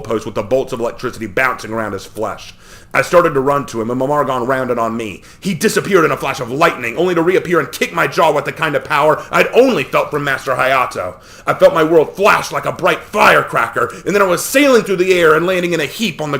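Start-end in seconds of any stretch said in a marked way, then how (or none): none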